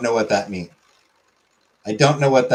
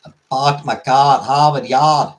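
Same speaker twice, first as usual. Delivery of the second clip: louder Boston accent, dropped R's; 5 dB louder than usual. Shown as a demonstration. Streamer trying the Boston accent on the famous phrase, Boston accent. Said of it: "for the Boston accent".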